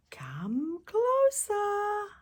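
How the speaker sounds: very slow, high creepy whisper; sinister whisper. Acted. Wolf luring the girl again, whispering. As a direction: coax her in eerily.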